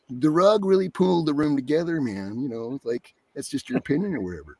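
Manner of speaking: laid-back West Coast character voice. Shown as a demonstration. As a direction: loosen it into a West Coast dude. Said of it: "made it a little more West Coast".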